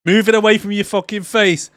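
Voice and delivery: high, loud mock old mum on FaceTime; loud, high imitation of a mum on a video call; shouty old mum. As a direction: yell it like your mum who can't work the phone. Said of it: "she's like".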